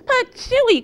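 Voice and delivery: high, loud spluttering Patooey; spitting cry. A narrator's reading. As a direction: spit it out with a loud high squeal. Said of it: "cried Goldilocks".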